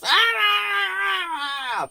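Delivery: long high drawn-out yell; mock screaming. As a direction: wail it like an angry skipper. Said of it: "Carl was screaming and yelling".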